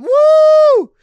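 a shrieking high woo to kick off the show; loud high whoop. Acted. Host whooping to open her show, loud and high.

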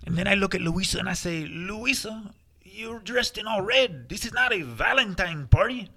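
lightly put-on Spanish accent, joke telling; a hint of a Spanish accent telling a party joke. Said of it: "always used an accent, so that's the only way I know how to tell it".

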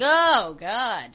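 big drawn-out "oh" of horror; mock dismay. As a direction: imitate people aghast at the news. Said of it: "They're like".

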